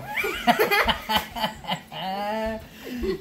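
breathless laugh; laughing fit. Real, not acted. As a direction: laugh till you wheeze. Laughing at struggle.

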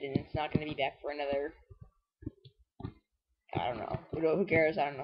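normal talking voice

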